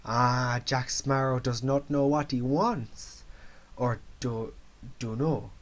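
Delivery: slow, badly faked Jamaican accent; amateur Jamaican accent, unhurried; terrible Jamaican accent. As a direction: draw it out in a hammed-up Jamaican accent. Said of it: "That was the world's worst Jamaican accent".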